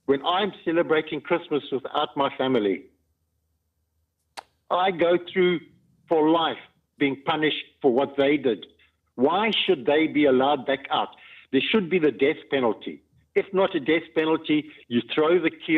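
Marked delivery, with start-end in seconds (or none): none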